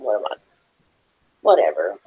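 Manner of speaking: ordinary talk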